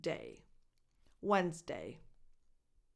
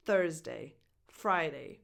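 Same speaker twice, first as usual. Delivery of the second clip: ordinary talk, nothing marked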